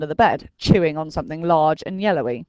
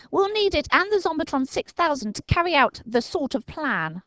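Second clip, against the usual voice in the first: put-on higher boy's voice, brisk; Dinking's voice; 10 semitones higher than usual. A narrator's reading. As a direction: announce the plan.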